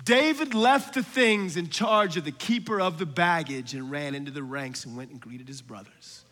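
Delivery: pointed reading that fades down; hushed re-reading. Real, not acted. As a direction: let the verse drop away to a low, soft close. Re-reads verse pointedly, dropping away.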